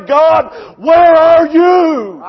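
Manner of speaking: long, drawn-out cry to God; anguished prayer. Retold or imitated. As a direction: call out to heaven, stretching every word. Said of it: "There's times I hit my face and I say".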